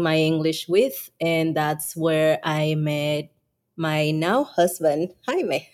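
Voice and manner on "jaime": Jaime said the Spanish way, HAI-meh, with a soft h; Spanish name Jaime. Real, not acted. Wife introduces her husband, saying Jaime the Spanish way, HAI-meh. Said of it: "You notice she called me Jaime".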